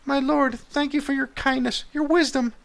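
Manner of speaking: very high put-on woman's voice; high falsetto wife thanking the king; grateful wife.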